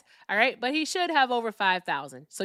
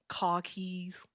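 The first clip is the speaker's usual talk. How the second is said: R-less Boston accent; 4 dB quieter than usual. Shown as a demonstration. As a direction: drop the R like a Bostonian. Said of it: "here's my Boston accent".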